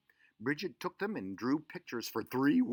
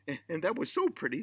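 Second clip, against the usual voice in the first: excited; 6 semitones higher, 5 dB louder, faster than usual. A narrator's reading. Narrator gushing over growing colors.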